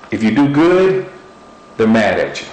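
ordinary talk

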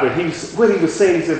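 ordinary delivery

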